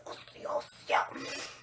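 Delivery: breathless whispered yes, yes, yes; barely voiced, secretly thrilled. Whispering to herself over the news, breathless.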